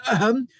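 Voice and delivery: a prim little throat clear; high fussy ahem. A narrator's reading. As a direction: clear the throat before going posh. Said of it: "clearing my throat".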